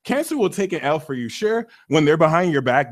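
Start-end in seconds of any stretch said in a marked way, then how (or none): none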